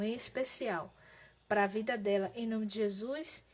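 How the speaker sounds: subdued, measured prayer with pauses; intercessory prayer. Real, not acted. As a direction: plead gently with God for someone ill. (oração de intercessão, serena e contida)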